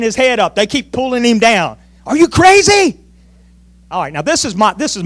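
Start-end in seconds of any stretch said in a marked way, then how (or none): 2.1-3.0 high-pitched, acted disbelief; incredulous scolding. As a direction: scold him as an appalled brother. Acting brothers pulling David down.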